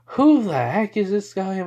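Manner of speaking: who is this clown, disbelieving; baffled outburst. Acted.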